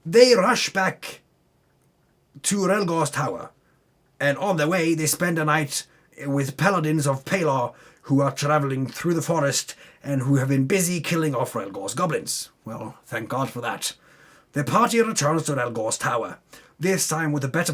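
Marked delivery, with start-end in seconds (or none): none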